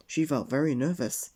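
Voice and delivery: usual speaking voice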